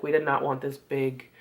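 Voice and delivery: ordinary delivery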